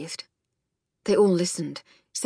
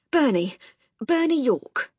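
breathless, higher introduction; out of breath; 6 semitones higher, 3 dB louder than usual. A narrator's reading. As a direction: puff out the nickname. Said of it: "still out of breath".

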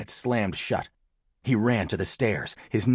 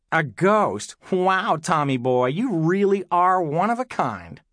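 higher, drawn-out teasing; mocking; 7 semitones higher, 4 dB louder than usual. A narrator's reading. Big sister ridiculing Tom's ghost story, mocking. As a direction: sneer it with big, slow mock amazement.

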